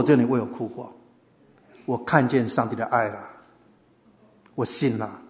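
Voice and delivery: low, softening heartfelt confession; moved sincerity. Retold or imitated. Host moved by cobbler's tears, quiet sincere confession. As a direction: let it sink quietly into sincere surrender.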